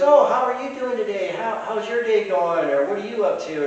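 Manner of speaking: high, lilting imitation of a kindly lady; lady greeting child. Lady kneeling to greet a little girl, high sweet imitation. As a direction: pitch it up as if talking to a small child. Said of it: "kneels down, and she goes".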